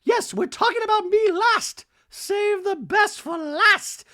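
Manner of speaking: hammed-up high Dorit; mock Dorit. Host voicing Dorit at lunch, high and loud.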